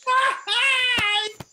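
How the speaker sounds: big laugh. Acted. Stephano delighted by jest.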